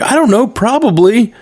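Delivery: high, shrugging mock reply; quoting a character. Retelling the cartoon scene, high unsure mock reply. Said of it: "she's like".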